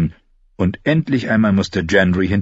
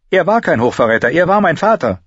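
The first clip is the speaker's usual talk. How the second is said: put-on higher girl's voice rising in protest; Arya's voice; 10 semitones higher, 4 dB louder than usual. Girl protests her father's honour, higher voice. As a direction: defend her father fiercely.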